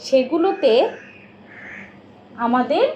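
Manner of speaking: usual speaking voice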